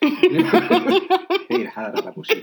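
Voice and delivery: laughing through the words; cracking up mid-sentence. Real, not acted. Bursting out laughing mid-answer.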